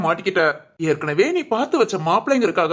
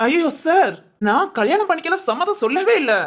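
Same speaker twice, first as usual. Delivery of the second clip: high, urgent denial; flustered protest; 7 semitones higher than usual. Acted. Accused visitor insists there was no consent, flustered protest. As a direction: plead innocence in a raised pitch.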